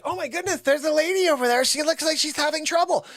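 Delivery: high-pitched imitation of a nurse; mock alarmed nurse. Nurse spots the fallen lady, mock high alarm. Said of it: "one of the nurses is like".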